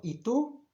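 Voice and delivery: "¿Y tú?" pitched high with a rising question; questioning intonation. Shown as a demonstration. Teacher shows how the question begins, questioning intonation. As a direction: lift "tú" sharply upward to ask. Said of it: "it's got to do with intonation".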